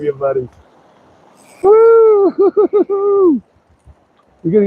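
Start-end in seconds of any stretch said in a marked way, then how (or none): 1.6-3.4 long, high, drawn-out whoop; excited whoop. Real, not acted. Angler whooping at a running trout, high drawn-out. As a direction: whoop as the fish takes line.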